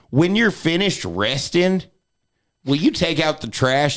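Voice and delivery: high, drawn-out grandma nagging; grandma's voice. Retold or imitated. Grandma nagging seated grandson, high drawn-out imitation. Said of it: "That's how she talked".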